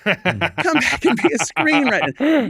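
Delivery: high, drawn-out fake begging; mock Hollywood plea. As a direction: call pleadingly in a put-on high voice. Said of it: "I'm acting like Hollywood's like".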